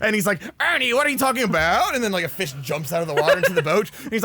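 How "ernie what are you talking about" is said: mock annoyed, high exasperated whine; Bert. Pissed-off puppet questioning his fishing partner, mock exasperation. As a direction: protest with hammed-up irritation. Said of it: "like, pissed off".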